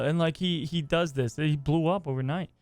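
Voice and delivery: ordinary talk, nothing marked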